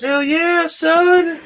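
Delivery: high, excited "hell yeah"; excited whoop. Real, not acted. Excited agreement about Foxwoods.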